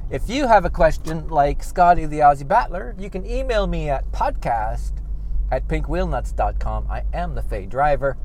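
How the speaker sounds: ordinary talk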